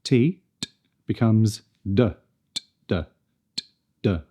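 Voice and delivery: "t, d" contrasting unvoiced and voiced; t then voiced d. Shown as a demonstration. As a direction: add voice to turn t into d. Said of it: "with a voice".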